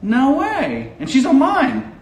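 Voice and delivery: high-pitched delighted disbelief; surprised. Real, not acted. Guest learns a known name is watching him, surprised. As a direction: react in high-pitched amazement at the news. Mock disbelief at a friend following.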